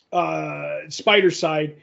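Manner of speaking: ordinary talk, nothing marked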